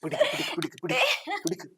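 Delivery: sharp high grab it calls; high shouts while lifting; urgent straining call. Acted. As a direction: strain and yell to a helper. Hauling the body together.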